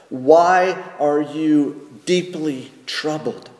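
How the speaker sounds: slow, measured reading of the question; scripture reading. Acted. Pastor rereading the psalmist's question, slow and measured. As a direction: read it slowly, as a question put to your own soul. Alternate translation read aloud.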